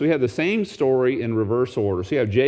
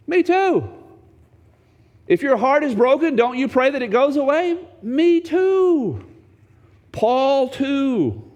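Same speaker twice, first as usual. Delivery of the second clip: emphatic admission; 12 semitones higher, slower than usual. Real, not acted. Preacher admitting personal prayer.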